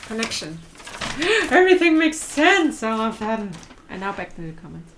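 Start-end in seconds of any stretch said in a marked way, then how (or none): 0.6-1.4 mock gasp. Sudden realization about Milch. 1.5-3.5 louder and higher, hammed-up sudden realization; over-the-top eureka moment; mock revelation. Sudden realization about Milch.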